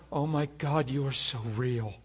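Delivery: hushed awe. Retold or imitated. Awe at God's presence.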